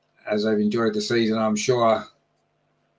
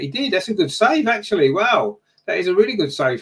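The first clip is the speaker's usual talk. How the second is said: high, loud burst of surprise at the save; real amazement at a good save; surprised delight; 7 semitones higher, 3 dB louder than usual. Real, not acted. Sudden great save.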